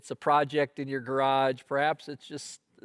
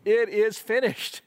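higher and louder, beaming with joy; triumphant; 7 semitones higher, 5 dB louder than usual. Retold or imitated. President announcing the vaccine, triumphant and beaming. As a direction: announce victory with a big smile. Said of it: "with that big smile, he says".

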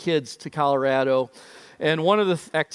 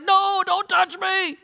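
put-on high, slow frightened child; panicked shout; 4 dB louder, slower than usual. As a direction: push them away in panic. Panicked boy, voiced.

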